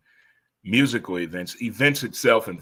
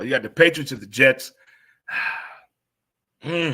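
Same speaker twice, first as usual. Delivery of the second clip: ordinary delivery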